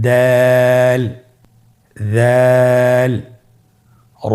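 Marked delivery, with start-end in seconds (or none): 0.1-3.1 long held Arabic letter দাল, vowel stretched; Arabic letter drawn out for learners; drawn-out letter name. Shown as a demonstration. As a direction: sing out দাল slow and even. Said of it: "হরফগুলো হলো".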